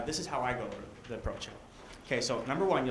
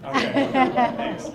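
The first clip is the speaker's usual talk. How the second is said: nervous laugh; 11 semitones higher, 14 dB louder, much slower than usual. Real, not acted.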